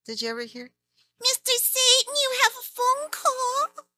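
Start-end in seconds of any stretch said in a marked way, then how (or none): 1.2-3.9 very high, tiny, slow sweet voice; cute secretary voice. Acted. Announcing a call to Mister Satan in a tiny high voice. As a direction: squeak it out like a tiny eager helper. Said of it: "It's Mister Satan's secretary".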